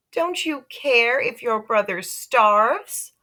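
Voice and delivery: mother reproaching. Acted. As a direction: lay the guilt on thick. Mother scolding Peter.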